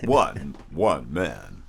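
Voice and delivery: deep, booming trailer announcer; movie trailer voice. Pretend. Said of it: "it's a trailer voice".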